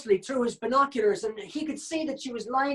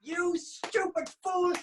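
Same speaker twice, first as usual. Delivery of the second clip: furious shout; 8 semitones higher, slower than usual. Acted. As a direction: yell at the greedy panda. Tony yelling at Martha.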